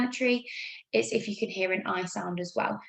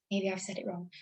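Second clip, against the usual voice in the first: usual speaking voice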